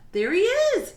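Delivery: loud, high shout of spotting him; excited yell. Acted. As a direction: call out and race off. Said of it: "Jack yelled".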